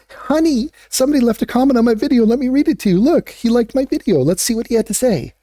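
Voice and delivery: high, fast, giddy imitation of a beginner creator; mock excited newbie. Telling partner about a first comment, mock excited and high. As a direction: gush in a high, eager voice. Acts out eager younger self to partner.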